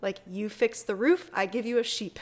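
ordinary talk, nothing marked

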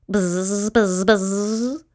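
repeated buzzes rising at the end; bee buzzing. A narrator's reading. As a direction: imitate buzzing bees. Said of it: "said one bee".

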